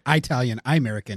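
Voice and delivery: "Italian" said with a long EYE at the start; mock mispronunciation. Shown as a demonstration. Teasing Art about Italian American, "Italian" mispronounced as EYE-talian. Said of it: "Or as I like to say".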